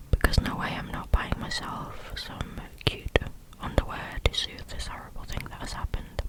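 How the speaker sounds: usual speaking voice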